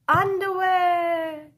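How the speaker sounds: playful slow punchline; comic emphasis. Acted. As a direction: stretch underwear for a laugh. Comic punchline word to kids.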